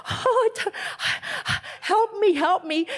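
mock panic. Panicking before meeting someone.

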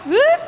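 quick high-pitched whoop; imitated rising "whoop"; comic whoop. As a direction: toss out a little high whoop. Acting out son's startled response.

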